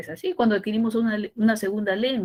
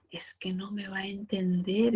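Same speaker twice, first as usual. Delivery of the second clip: whispered excuse, trailing up; timid whisper; 5 dB quieter than usual. Retold or imitated. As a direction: whisper it like an unsure mother. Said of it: "decía". (susurro inseguro de la mamá)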